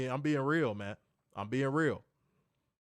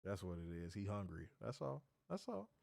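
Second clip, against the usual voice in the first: low, quiet mock commentary; hushed teasing; 7 semitones lower, 10 dB quieter than usual. Real, not acted. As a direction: talk about him under your breath.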